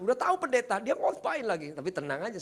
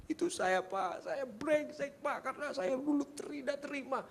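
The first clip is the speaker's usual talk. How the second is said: imitated crying; 7 semitones higher, 5 dB quieter than usual. Driver confesses he is B to preacher, mock crying. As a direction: whimper the words out, stretched. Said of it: "Dia nangis terus".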